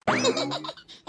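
high, gleeful laugh; escaping laugh. Acted. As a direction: laugh as you get away. Sister laughs as she escapes. (தப்பி ஓடும்போது உரத்த சிரிப்பு)

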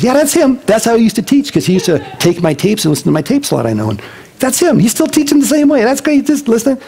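put-on high, beaming, recognizing an old voice; delighted recognition. Friend hearing the old teaching again, delighted and high. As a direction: gush like you just found a lost friend.

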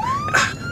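exasperated sigh. Acted.

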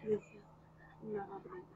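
unvoiced worried whisper; scared whisper to herself. A narrator's reading. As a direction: hush it, slowly, in disbelief. Inner fear whispered.

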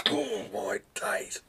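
slow, whispered mock amazement; slow whispered exaggerated awe; hammed-up awe. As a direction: gasp it out in fake wonder. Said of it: "gonna get an Oscar for that acting".

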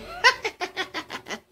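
high wicked cackle; shrill eerie cackle; raucous laughter. Acted. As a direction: cackle like a witch in the night. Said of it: "bellowed out in a loud, raucous laughter".

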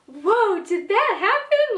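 surprised recall. Retold or imitated. Watching the show with mom, surprised. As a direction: re-enact a startled whoa, voice jumping up. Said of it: "I was just sitting there like".

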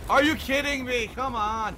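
loud, high exclamation; real exasperation; outraged disbelief. Real, not acted. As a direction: cry out at the unfairness. Player outraged at game death.

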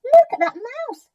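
high, loud monkey voice; excited story-book monkey; chattering monkey. A narrator's reading. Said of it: "chattered a monkey".

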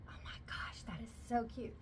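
breathless, unvoiced whisper of delight. Real, not acted. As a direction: whisper it as if you can't believe how cute it is. Excited whisper over new bag.

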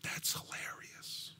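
very soft, slow whisper; whispered aside. Real, not acted. Preacher commenting on Elijah's complaint, whispered aside. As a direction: drop to a whisper, drawing it out.

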